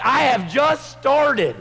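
high, loud, slow shout; defiant shout. Retold or imitated. Preacher answering critics of his prayer, shouting. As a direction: fire it back at the critics. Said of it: "I'm like".

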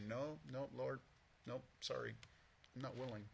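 soft, half-hearted "no, nope, sorry"; reluctant mumbled refusal. Pretend. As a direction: shrug off God's request under the breath. Mocking our reluctant refusals to God.

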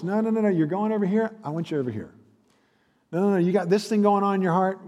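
quick, high, insistent no no no; Jesus correcting you. Acted. Jesus steering you back, urgent and high.

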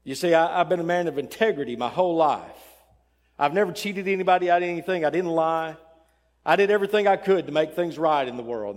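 acted protest of a good man; self-righteous insistence. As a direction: argue your record forcefully. Second man argues his merit.

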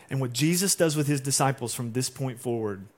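ordinary talk

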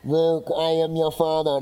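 Darth Vader impression. Pastor joking to the church, in a slow mock Vader voice. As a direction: do a quick Vader line for laughs. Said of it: "this is my Darth Vader".

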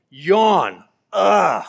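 loud hammed-up yawn and groan; mock the boredom with a big yawn. Preacher mocking a vapid life, hammed-up loud yawn and ugh.

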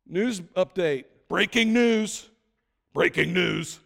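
slow, loud announcer voice; news alert voice. Pretend.

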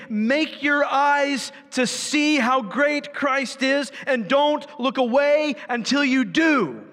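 high, urgent, rising sermon voice; fired-up pulpit intensity; impassioned preaching. Acted. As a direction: preach it with mounting fervour. Preaching at full force.

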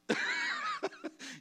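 short real laugh; amused chuckle at your own remark. Real, not acted. Preacher to church after a wry joke, chuckling.

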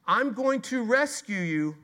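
high, loud, bright promise; a booming divine vow; God's promise. Acted. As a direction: call out the rescue like God himself. God's promise against grumbling.

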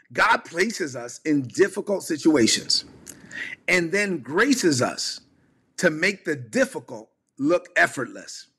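slow, deliberate enunciation; over enunciating. Shown as a demonstration. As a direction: spell it out word by word. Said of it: "Let me say it slowly".

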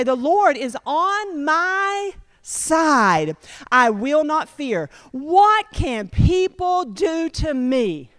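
slow, reverent recitation of the verse; slow scripture reading. Acted. Preacher reading the psalm to the congregation, slowly and reverently. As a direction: draw every word out like a proclamation. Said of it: "Then it goes on to say".

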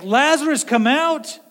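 Lazarus called out high and loud, as a command. Retold or imitated. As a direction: call him out of the tomb with full authority. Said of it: "And he's like".

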